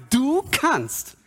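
high, emphatic declaration to God; trusting declaration. Acted. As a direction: hand it over with conviction. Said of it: "lehne mich einfach zurück und sage".

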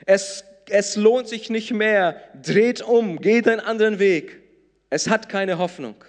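loud hopeless voice declaring it too late; discouraging voice. Acted. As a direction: voice of discouragement urging them to turn back. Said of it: "Die erste Stimme sagt".

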